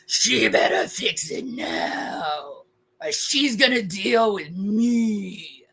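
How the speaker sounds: slow, drawn-out menacing warning; mock threat. Woman joking that she must fix it, slow mock menace. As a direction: stretch every word into a hammed-up threat.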